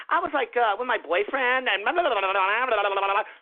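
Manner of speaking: mocking vlogger babble. Ranter mimicking a vlogger rambling about a boyfriend, mock vlogger babble. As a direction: parody a vlogger prattling on into gibberish. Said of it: "the way they vlog".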